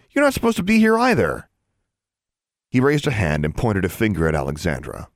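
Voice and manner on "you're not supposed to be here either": defiant. A narrator's reading. Girl defies older boy.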